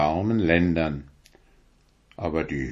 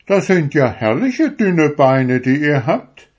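high, loud sneering turkey; high, loud turkey mocking the stork; 7 semitones higher, 6 dB louder than usual. A narrator's reading. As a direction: sneer at the stork's thin legs. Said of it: "sagte der Kalekute".

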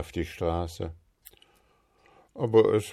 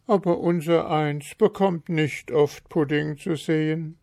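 wistful wife; 9 semitones higher than usual. A narrator's reading. Frau Marker sighs.

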